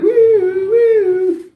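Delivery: high, loud falsetto police siren wail; mock a cop siren coming; siren imitation. Reads chat siren.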